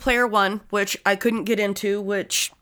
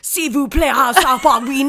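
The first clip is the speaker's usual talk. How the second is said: loud, higher mock French gibberish; fake French; 5 semitones higher, 6 dB louder than usual. Mocking French as the movie cops argue, loud fake French accent. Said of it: "Mac are arguing".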